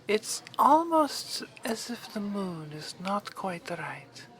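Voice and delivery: usual speaking voice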